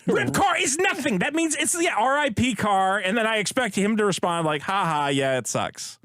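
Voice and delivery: exasperated. Real, not acted. Exasperated defending himself loudly.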